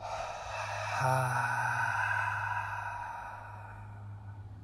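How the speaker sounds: long unvoiced lion's breath ha; breathy ha exhale. Shown as a demonstration. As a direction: push the air out on a long ha. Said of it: "by making a long ha sound".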